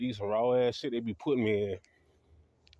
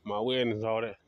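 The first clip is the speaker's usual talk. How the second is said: ordinary talk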